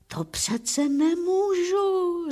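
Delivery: high, slow, drawn-out protest; high-pitched, slow objection; protesting old man. A narrator's reading. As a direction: stretch it out in a high character voice. Petr protests to the driver.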